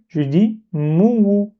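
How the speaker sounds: mouwou said slowly, syllables distinct; mouwou pronounced. Shown as a demonstration. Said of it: "Si je fais dhamma ici". (mouwou articulé lentement)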